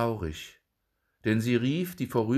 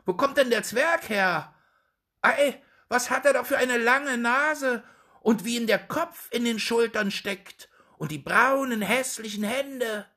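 high, loud mocking shouts from the street; jeering crowd calls; 14 semitones higher, 4 dB louder than usual. A narrator's reading. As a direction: call out like gawking townsfolk. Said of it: "Überall hörte er rufen".

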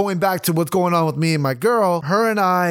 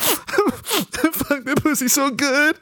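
high-pitched mock sobbing; sniffling and crying; 10 semitones higher, much slower than usual. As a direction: whimper it in a much higher, tearful voice. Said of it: "just sniffling and crying".

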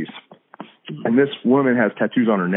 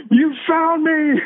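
high, slow mock scream of delight; retold scream; much slower than usual. Woman in Walmart shrieking at the Christians, high drawn-out mock scream. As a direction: stretch it out high like a thrilled shriek. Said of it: "screamed at us".

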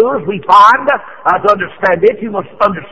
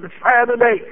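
ordinary talk

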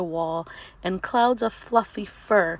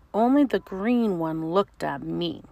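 ordinary delivery, nothing marked

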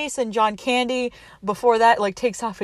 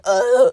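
louder drawn-out "ugh" of mock disgust; disgusted groan; 3 semitones higher, 6 dB louder than usual. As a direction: groan as if about to barf. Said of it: "looks like he's going to barf".

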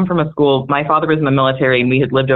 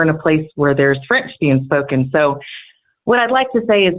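Southern drawl teacher; 3 semitones higher than usual. Retold or imitated. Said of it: "he sits down and he says".

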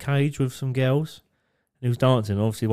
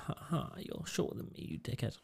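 soft teasing; 16 dB quieter than usual. Real, not acted. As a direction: murmur the insult softly.

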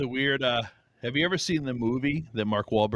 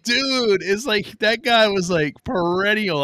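much higher, louder, amazed rant; excited disbelief; 8 semitones higher, 5 dB louder, much slower than usual. Retold or imitated. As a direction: marvel at how he never came to school. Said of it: "it was like".

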